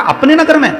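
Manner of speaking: high-pitched, startled echo; acted surprise at the news; taken aback. King reacts to the news. (चौंककर, ऊँचे सुर में)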